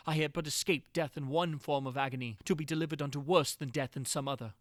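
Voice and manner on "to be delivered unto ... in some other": ordinary delivery, nothing marked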